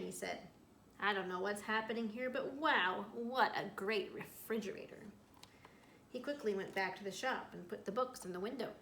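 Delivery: reading a story aloud to a class; storyteller voice for young listeners; picture book read-aloud. A narrator's reading. Teacher reads picture book aloud.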